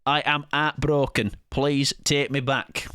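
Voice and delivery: drawn-out Northern heartbreak; Northern accent. Retold or imitated. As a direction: Shrimp begging back in a Northern accent. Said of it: "I don't know what that accent is".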